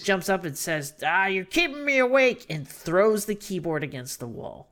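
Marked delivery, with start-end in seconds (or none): 1.0-2.4 gruff yell, higher and louder; angry shout. Retold or imitated. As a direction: snap at her for waking him. Eustace yelling in retelling.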